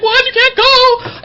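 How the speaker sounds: high, loud, startled mother; mom's shocked reply. Retold or imitated. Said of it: "She's like".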